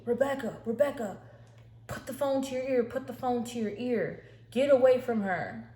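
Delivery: urgent, high-pitched mock yell; retold screaming. Husband on the phone warns Rebecca, retold screaming.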